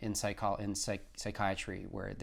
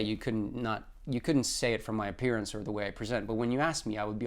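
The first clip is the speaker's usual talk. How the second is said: ordinary delivery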